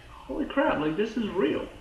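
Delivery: high, amazed gasp of disbelief; stunned disbelief. Retold or imitated. Fan reacting to a TV twist, stunned and high. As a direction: voice jumps up in shock. Viewer reacting to shock scene.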